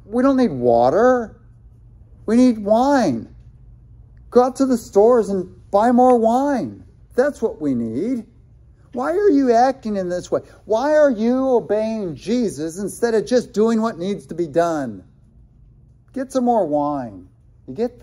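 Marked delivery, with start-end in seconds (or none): 0.1-8.3 higher, louder demand for wine; lightly voiced guests demanding wine. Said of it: "the party can say". 8.9-17.3 higher, incredulous scolding; mock the servants' obedience; a touch of sneering disbelief; scoffing critic.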